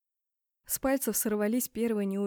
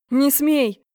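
very loud, higher warning; forbidding shout; 5 semitones higher, 11 dB louder than usual. A narrator's reading. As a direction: shout to stop someone at once. Lunges to stop her. (резкий крик-запрет)

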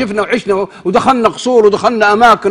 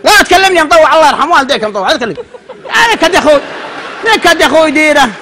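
grumpy stranger; 7 semitones higher than usual. Retold or imitated. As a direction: push the preacher away irritably. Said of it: "قال". (رجل عند الإشارة يصد المطوع بتذمر وصوت مرتفع)

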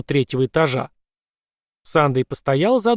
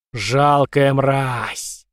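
very slow, booming monster voice; thundering vampire; much slower than usual. A narrator's reading. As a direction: roar it like a vampire lord. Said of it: "прогремел вампир".

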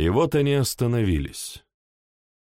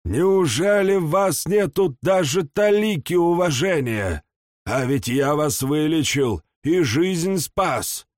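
put-on reproachful old man; 8 semitones higher than usual. A narrator's reading. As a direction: chide him like an offended elder. Old god scolds Arthur.

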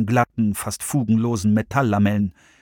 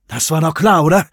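higher nervous voice, a little louder; Russ's voice; 4 semitones higher, 5 dB louder than usual. A narrator's reading. Russ to George at the glass door, nervous. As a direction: raise it, edgy and tense.

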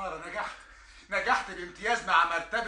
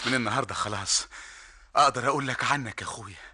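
lower, gentle promise; tender; 6 semitones lower than usual. Acted. Younger brother tenderly promises his brother a new life.

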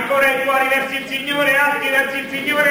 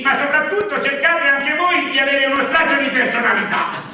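usual speaking voice

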